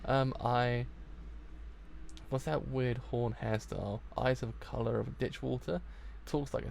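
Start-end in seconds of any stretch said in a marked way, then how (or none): none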